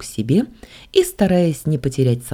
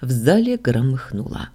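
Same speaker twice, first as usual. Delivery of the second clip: usual speaking voice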